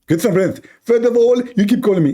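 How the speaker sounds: high, mocking preacher voice; Zakir Naik impression. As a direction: parody a lecturing cleric, pitched high. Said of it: "Zakir Naik".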